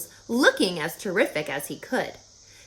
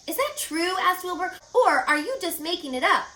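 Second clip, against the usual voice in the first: pig's voice; 9 semitones higher than usual. Acted. Wilbur doubtful question.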